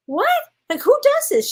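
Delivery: high, loud disbelief; incredulous. Retold or imitated. Telling a friend about the parade, high disbelief. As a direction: ask it like you can't believe it.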